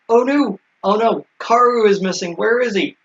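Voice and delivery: lightly hammed-up worry; mock panic. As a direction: fret in pretend dismay. Voicing alarm at a missing character.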